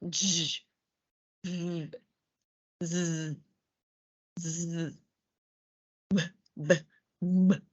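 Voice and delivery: J, v, z, b, m buzzed softly; quiet buzzing voiced sounds; teacher demoing voiced consonants. Shown as a demonstration. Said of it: "the vocal cords vibrate".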